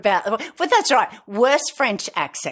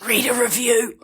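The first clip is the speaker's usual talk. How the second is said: conspiratorial stage whisper; playful whispered advice; whispered urging; 2 semitones higher than usual. Pretend. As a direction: whisper it as a secret tip. Whispered aside under co-host's warning.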